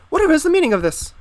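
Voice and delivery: high, loud, fast falsetto, scandalized; a scandalized lady's falsetto outburst; affronted falsetto lady. Acted. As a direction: shriek it like a shocked society lady.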